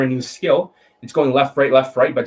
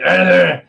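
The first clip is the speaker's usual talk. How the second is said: loud mocking growl, pitched up; mock angry growl; 5 semitones higher, 6 dB louder than usual. Coach mimicking how slow-talking students sound, angry growl. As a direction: imitate an angry grunt. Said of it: "they sound angry. They're like".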